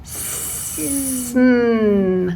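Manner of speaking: "Sn" drawn out, no vowel sound; sounding out words. Shown as a demonstration. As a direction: stretch the "Sn" blend slowly. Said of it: "Say, say it slow".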